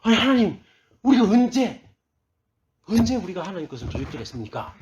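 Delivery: high, loud indignant protest easing off at the end; heated arguing back. Retold or imitated. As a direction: imitate riled-up people disputing with God. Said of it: "또 열받아가지고 하나님 앞에 막 따집니다".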